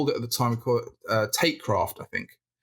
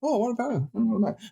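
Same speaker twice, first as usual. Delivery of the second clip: excited musing; 9 semitones higher, faster than usual. Retold or imitated. Dictating idea to wife.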